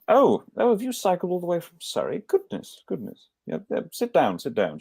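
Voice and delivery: put-on high, fussy older lady fretting over the rider; fussing matron. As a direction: flutter over her like a worried matron. Mrs Dunham fussing over cyclist.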